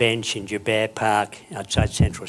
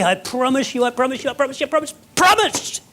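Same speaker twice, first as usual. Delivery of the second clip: rising higher and louder to a shout; building promise; 11 semitones higher, 4 dB louder, faster than usual. Real, not acted. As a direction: build each promise until you shout it.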